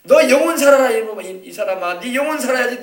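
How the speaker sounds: high, loud urgent rebuke; God rebuking a careless soul; God scolding. Acted. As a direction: plead and scold at once. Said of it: "하나님도 그런 거".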